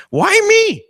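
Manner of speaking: very high, loud squeaky why-me; asked by his father-in-law to pray, squeaked comic protest; mock protest. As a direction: yelp it in comic dismay.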